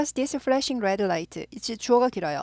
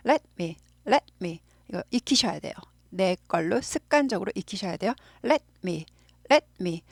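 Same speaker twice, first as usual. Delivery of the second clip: usual speaking voice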